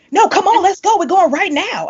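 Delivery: high, urgent hustling; insistent. Retold or imitated. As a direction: imitate a friend who won't take no. Retells friend insisting they go now.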